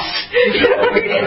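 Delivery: high laughing cry of relief. Real, not acted. Overwhelmed by recognition, laughing and crying out.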